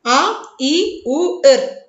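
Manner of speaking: आ, ई, उ, एर said as short clipped sounds; name each short vowel crisply; teacher listing the short vowels one by one; short vowels demo. Shown as a demonstration. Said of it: "ये तो ह्रस्व स्वर है".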